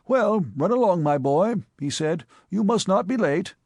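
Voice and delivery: teasing his nephew, amused and knowing; jovial uncle. A narrator's reading. Sir Thomas teasing his nephew over the letter, lightly jovial.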